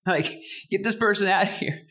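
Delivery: high mock shout; pretend yell. Preacher imagining throwing out the interrupter, mock shouting. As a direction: order the guy out, hammed up. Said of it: "I would yell".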